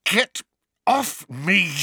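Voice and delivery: high, strained gasp; loud gasping protest; gasped plea. A narrator's reading. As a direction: choke it out, as if being throttled. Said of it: "Harry gasped".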